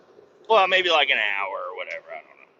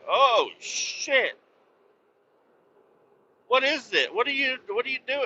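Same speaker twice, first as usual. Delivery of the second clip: much higher, fast, startled; startled soldiers; 11 semitones higher than usual. Retold or imitated. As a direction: soldiers shocked the body talks. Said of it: "And they're like".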